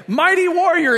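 high, loud proclamation of a title; booming angelic address. Retold or imitated. Preacher voicing the angel hailing Gideon, loud and high. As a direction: call him out grandly, like a herald. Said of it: "he gets addressed this way".

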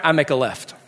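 usual speaking voice

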